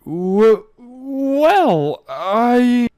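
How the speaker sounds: high, stuttering protest; flustered mare trying to answer; stammering. Acted. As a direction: trip over the start. Trixie stammers.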